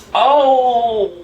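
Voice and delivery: loud, high "Oh!" of sudden memory; sudden realization. Real, not acted. Remembering the first night out with his friend, a loud surprised oh. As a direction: burst out as the memory hits.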